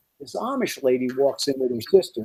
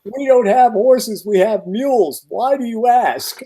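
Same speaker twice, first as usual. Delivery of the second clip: slow, higher-pitched imitation of a mother; mock-suspicious mom, slow and raised in pitch; imitated wary mother; 7 semitones higher, slower than usual. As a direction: draw it out high, questioning a stranger.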